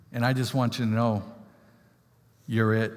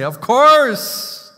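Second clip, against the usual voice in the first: high, loud delighted "Of course"; eager bride's yes; 10 semitones higher, 10 dB louder than usual. Retold or imitated. Preacher voicing the bride's joyful answer, high and loud. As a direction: burst out with joy. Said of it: "you want her to say".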